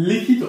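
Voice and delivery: লিখিত said loud with a final o, likhito; teacher stresses লিখিত, ending on o; লিখিত spoken as likhito, showing the o ending; teacher demo word. Shown as a demonstration. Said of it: "লিখিতর ক্ষেত্রে তটা আমার কি শব্দের শেষে আসে না?".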